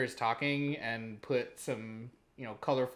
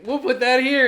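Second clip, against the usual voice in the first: higher and louder, breezy promise; mocking his past self promising edits, higher and louder; upbeat self-quote; 11 semitones higher, 13 dB louder than usual. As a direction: toss it off like it's no work at all. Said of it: "I've been like".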